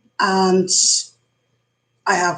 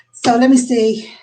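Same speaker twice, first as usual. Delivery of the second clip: ordinary talk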